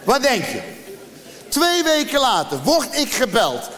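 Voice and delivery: ordinary talk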